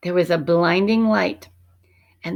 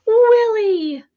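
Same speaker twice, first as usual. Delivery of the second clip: high joyful cry; cried out; 7 dB louder than usual. A narrator's reading. Alexander finding his friend as a mouse, crying out for joy. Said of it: "cried Alexander".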